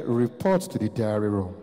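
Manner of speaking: low reality-show summons; deep announcer voice. Retold or imitated. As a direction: intone it deep like an official announcement. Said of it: "I thought I was going to hear".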